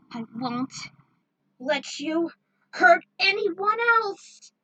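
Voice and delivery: building to a slow loud high yell; defiant battle cry. Acted. Heroine attacking the tyrant, slow loud defiant yell. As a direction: hurl the vow with everything left.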